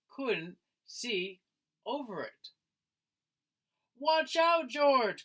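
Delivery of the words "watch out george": high, loud call of warning; a mother's alarmed warning shout. Acted. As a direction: call out to George from up the hill. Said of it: "called Missus".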